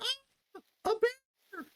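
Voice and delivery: very high, thin mock scream; hammed-up falsetto panic; mock fright. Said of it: "he's like".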